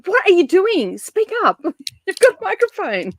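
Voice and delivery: high-pitched hammed-up complaint; mock exasperated shouting. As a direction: nag her to speak up, half laughing. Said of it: "I'd be down the Voxer the whole time going".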